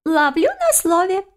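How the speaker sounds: very high, slow piping voice; stingy little creature. A narrator's reading. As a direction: take them up on it, squeakily. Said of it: "отозвался прижимистый незнакомец".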